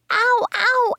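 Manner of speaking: put-on very high child's ows; pained yell. A narrator's reading. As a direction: yelp in exaggerated pain. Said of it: "I yelled again".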